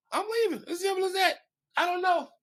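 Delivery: very high, squeaky falsetto; high-pitched mock protest; comic falsetto. Real, not acted. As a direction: shoot up into a falsetto for comic finality. Comic high-voiced repeat of leaving.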